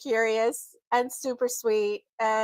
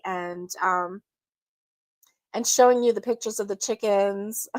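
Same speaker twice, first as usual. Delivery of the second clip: ordinary talk, nothing marked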